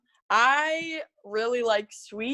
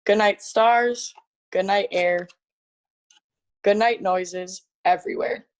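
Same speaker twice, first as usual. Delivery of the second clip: bedtime story reading. Acted. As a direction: read it like tucking a child in. Reads Goodnight Moon aloud.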